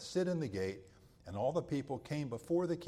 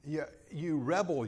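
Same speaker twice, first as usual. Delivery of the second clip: accusing cry; 2 semitones higher than usual. Retold or imitated.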